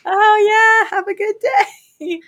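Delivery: sing-song brush-off to end it fast; fake cheery brush-off. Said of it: "I was like".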